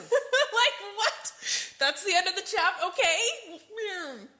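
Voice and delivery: high laughing what; giggly end-of-chapter disbelief. Real, not acted. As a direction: laugh out the surprise.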